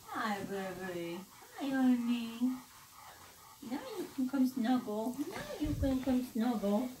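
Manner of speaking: high, sweet pet talk; cooing to the dog. Real, not acted. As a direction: coo to the dog lovingly.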